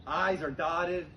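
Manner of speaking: usual speaking voice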